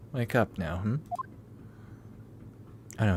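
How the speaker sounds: usual speaking voice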